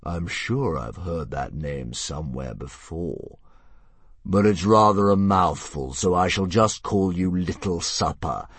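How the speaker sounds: slow, grand dragon; a lazy monster toying with prey. Acted. Narrator voices the dragon.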